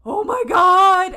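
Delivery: high, very loud, drawn-out cry; awestruck scream. Retold or imitated. Fan staring at the screen as the song starts, screaming. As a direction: shriek it slowly in disbelief. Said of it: "then I started screaming".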